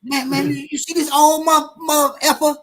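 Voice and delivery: high, hammed-up threat with shouted bits; threatening young man. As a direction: brag to the stranger about beating the old man.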